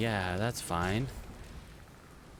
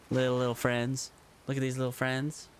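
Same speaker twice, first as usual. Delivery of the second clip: cooing little friends; 3 semitones higher than usual. Real, not acted. Cooing at small creatures.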